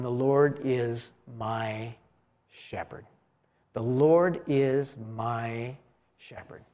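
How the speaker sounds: slow, low and soft, word by word; hushed, drawn-out verse reading; slow recitation. Shown as a demonstration. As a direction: let each word sink in, dropping away on the last. Slowly recites verse for congregation.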